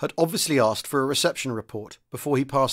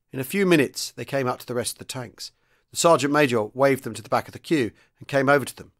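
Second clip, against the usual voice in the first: ordinary delivery, nothing marked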